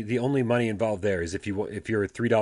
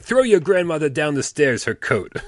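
put-on slow, higher, louder Italian accent; Italian grandmother; 7 semitones higher, 6 dB louder, slower than usual. Grandson imitating grandmother's mixed-up English, Italian accent. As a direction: call it out slowly with an Italian accent. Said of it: "like, very Italian".

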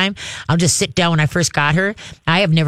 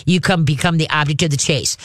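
ordinary talk

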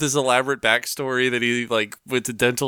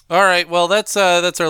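louder, higher all right; loud restart; 4 semitones higher, 6 dB louder than usual. Real, not acted. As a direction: announce it to move on. Host restarts the segment.

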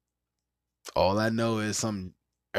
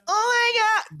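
high squealed mock shock, louder; Nickelodeon kid shock; 6 dB louder, slower than usual. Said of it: "he's like".

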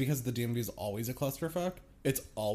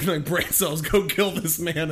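laughing mock command; 4 semitones higher, 7 dB louder than usual.

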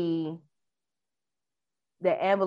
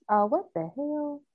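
surprised; 9 semitones higher than usual. Real, not acted. Touchscreen glitch interrupts reading.

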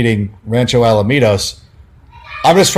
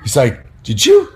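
heated colleague; 4 semitones higher, slower than usual. Retold or imitated. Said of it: "he was all heated".

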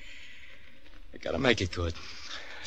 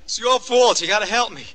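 high, loud, rushed accusation; angry blame; 7 semitones higher, 4 dB louder than usual. Acted. Son blaming his mother for his trouble, heated high outburst. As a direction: throw the blame at her hard.

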